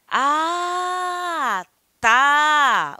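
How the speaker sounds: loud high "Ah," then a clear break before "tá"; separated ah, tá. Shown as a demonstration. Teacher shows the interjection with a pause. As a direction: say "Ah," and "tá" as two words. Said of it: "Lembrando que é separado".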